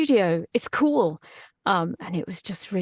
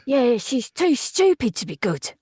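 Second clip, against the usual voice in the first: rough London dark wizard, higher and louder; 5 semitones higher, 4 dB louder than usual. Shown as a demonstration. Still demoing the dark wizard for the host, rough London voice. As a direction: jeer like a down-market London villainess. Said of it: "What's that dark wizard voice?".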